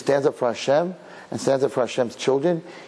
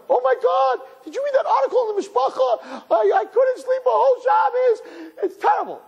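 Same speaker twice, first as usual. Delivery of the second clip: gossiping reader. Retold or imitated. Mocking readers shocked by a Mishpacha article, high and shrill. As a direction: ham up the scandalised reader. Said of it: "everyone's like".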